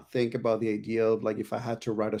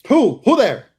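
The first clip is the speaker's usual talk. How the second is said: playful shout; 12 semitones higher, 11 dB louder than usual. Acted.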